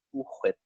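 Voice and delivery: "Cool Whip" with an overdone breathy H in "Whip"; "Cool Whip" with a raspy "hw"; Stewie. Retold or imitated. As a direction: say "Whip" as "hhwip". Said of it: "Stewie is annoying Brian by saying".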